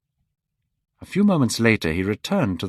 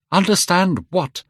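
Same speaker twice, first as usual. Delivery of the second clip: higher and louder, indignant; angry question; 9 semitones higher, 4 dB louder than usual. A narrator's reading. Dorian confronting Sybil, an angry question. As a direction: demand it sharply. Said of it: "he asked angrily".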